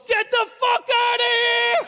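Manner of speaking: slow, high, loud yell; furious shout. Retold or imitated. Trainer yelling the owner out, furious shout. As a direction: roar it to throw them out. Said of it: "he just goes".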